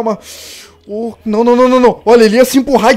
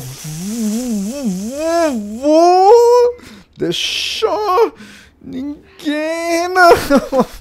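mock sobbing; 6 semitones higher, much slower than usual. Threat collapses into fake sobbing.

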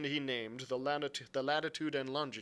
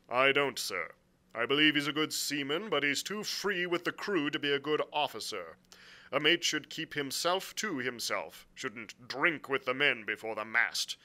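lightly voiced frank captain; blunt sea captain; 5 semitones higher, 7 dB louder than usual. Captain answering the angry squire, bluntly. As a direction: answer with a touch of stiff formality. Captain's dialogue beside narration.

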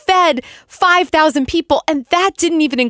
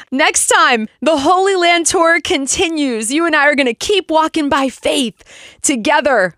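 ordinary talk

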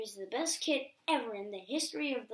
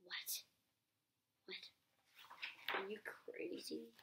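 whisper; 3 semitones lower, 14 dB quieter than usual. Acted. Aside whispered to listener.

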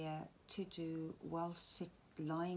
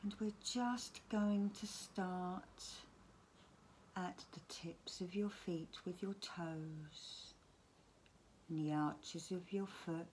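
guided relaxation. Acted. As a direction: gently talk them down towards sleep, phrase by phrase. Leads a bedtime relaxation.

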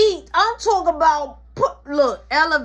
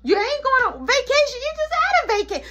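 high, rushing outburst; indignant scolding; 9 semitones higher, faster than usual. Acted. Mama cancelling the vacation, high indignant scolding. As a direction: rant it, pitch shooting up.

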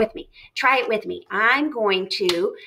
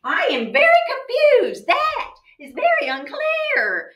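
hammed-up confusion; 14 semitones higher than usual. Shown as a demonstration. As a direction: play up being baffled for the class. Teacher hams up confusion.

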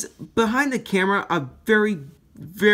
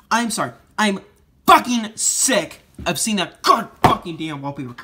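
louder, higher, still fuming; angry outburst; 4 semitones higher, 5 dB louder than usual. Real, not acted. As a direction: spit it out, still furious. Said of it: "that's how annoyed I am".

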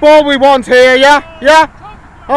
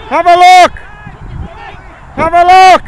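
louder call across the pitch; yelling from the sidelines; 5 semitones higher, 4 dB louder than usual. Real, not acted. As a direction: shout the instruction out to the player. Coach calling to player on pitch.

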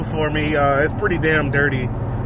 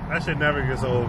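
ordinary talk

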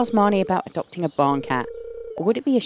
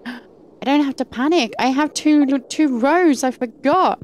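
relieved excitement; 5 semitones higher, 4 dB louder than usual. Real, not acted. Relieved at finding inventory space.

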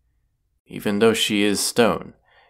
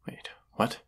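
a soft whispered double take; startled whisper; 8 dB quieter than usual. Acted. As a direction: catch your breath, whisper it in shock. Startled by news of sister.

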